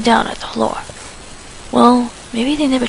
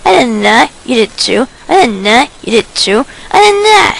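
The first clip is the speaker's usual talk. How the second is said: bickering; 8 dB louder than usual. Acted. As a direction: trade it faster and louder each time. Quarrel escalating back and forth.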